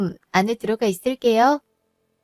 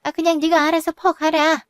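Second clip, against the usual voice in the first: higher-pitched, exasperated brush-off; irritated dismissal; 6 semitones higher than usual. Acted. Mother-in-law tells daughter-in-law to serve herself, irritated. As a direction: wave her away with raised pitch.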